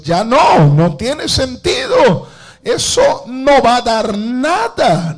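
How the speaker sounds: high, loud protest of a quitter; loud, high-pitched cry of defeat; someone giving up. Acted. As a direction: act out throwing in the shovel, exasperated. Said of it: "Alguien ya quiere soltar la pala y decir".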